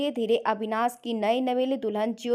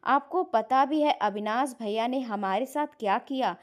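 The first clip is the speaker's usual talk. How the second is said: ordinary delivery, nothing marked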